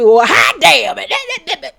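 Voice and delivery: slow, drawn-out mock of a loud friend, with a laugh; loud-talking Nell. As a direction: stretch it out laughing, doing Nell's loud way of talking. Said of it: "Nell is a loud talker".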